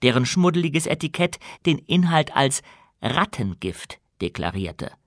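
ordinary delivery

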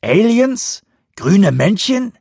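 very high, loud incredulous questions; scandalised, sky-high rising pitch; aghast. A narrator's reading. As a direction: squeak out each question in disbelief. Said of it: "fragte Vanderbilt entgeistert".

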